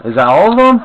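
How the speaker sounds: high excited check; excited question. Real, not acted. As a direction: sound thrilled at the end. Excited question mid-game.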